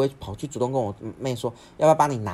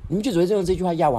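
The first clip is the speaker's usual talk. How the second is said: high, loud retold outburst; furious accusation; 10 semitones higher, 8 dB louder, faster than usual. Brother yelling at older sibling who pressures him, furious accusation. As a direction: act out a defiant shout of grievance. Brother's angry line acted out again.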